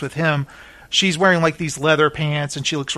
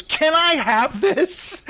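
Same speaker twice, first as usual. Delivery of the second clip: high, drawn-out mock plea; imitating gushing excitement; eager begging; 11 semitones higher, slower than usual. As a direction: ask like an overexcited guest. Retelling guest eagerly grabbing toy.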